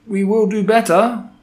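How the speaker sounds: high-pitched chorus of cowed witches; high, eager-to-please follower; murmuring underlings. A narrator's reading. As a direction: promise it meekly in a high voice. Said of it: "murmured the audience".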